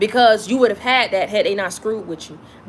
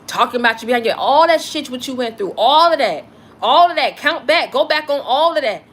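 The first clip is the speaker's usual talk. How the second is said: higher, louder preacher build to a fiery peak; preaching at full force; 4 semitones higher, 3 dB louder than usual. Real, not acted. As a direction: preach it out, rising with fervor. Preaching climax to viewers.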